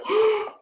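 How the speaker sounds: a sudden loud high gasp of shock; sharp gasp. Retold or imitated. Gasp after surprise news.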